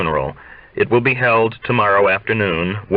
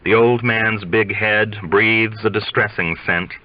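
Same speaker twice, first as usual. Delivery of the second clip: plain reading voice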